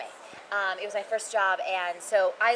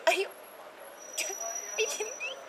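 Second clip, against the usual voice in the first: tearful disbelief; 14 semitones higher, 6 dB quieter than usual. Retold or imitated. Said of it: "I literally cried".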